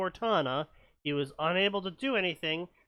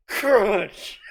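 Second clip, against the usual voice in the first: loud, high crunch sound; loud spoken crunch; 6 semitones higher, 11 dB louder than usual. Retold or imitated. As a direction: say it like an impact. Landing noise imitated.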